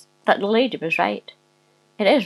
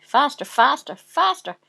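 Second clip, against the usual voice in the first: rising, higher cries of mounting excitement; gleeful; 6 semitones higher than usual. A narrator's reading. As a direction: urge him on with acted glee. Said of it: "she says with glee".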